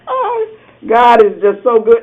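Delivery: usual speaking voice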